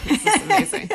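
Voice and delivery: laughing through the words; can't hold back laughter. Real, not acted. Reading absurd study, laughing.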